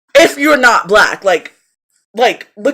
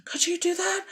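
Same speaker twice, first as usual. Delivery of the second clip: much softer, higher, quick curious murmur; 5 semitones higher, 14 dB quieter than usual. Retold or imitated. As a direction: send up their casual curiosity. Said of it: "All the remarks were, were like".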